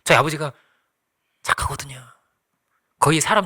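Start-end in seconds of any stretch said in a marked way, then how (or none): 1.4-2.1 hushed whisper; whispered aside. Real, not acted. Whispering fondly to the congregation about his kind father. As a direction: breathe it out softly.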